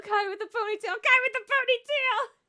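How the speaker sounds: rising from excited to a high, loud squeal; building fangirl squeal. Real, not acted. Gamer squealing over a ponytail, building to a high shriek.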